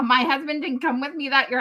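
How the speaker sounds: ordinary talk, nothing marked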